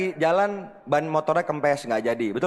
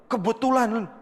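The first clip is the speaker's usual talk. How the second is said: higher, eager imitation of a friend pitching; eager excitement; 7 semitones higher than usual. Friend inviting him to a business meeting, imitated keenly. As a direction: mimic his keen, too-eager pitch. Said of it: "Ngomongnya semangat banget dia".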